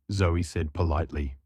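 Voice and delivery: ordinary delivery, nothing marked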